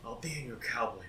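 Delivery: half-hearted celebrity impression, low and very soft; uncommitted, hushed movie-star voice; Nicolas Cage. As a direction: drop into a quiet, badly aimed actor impression. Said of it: "I don't know why I did Nicolas Cage".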